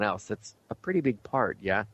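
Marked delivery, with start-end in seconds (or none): none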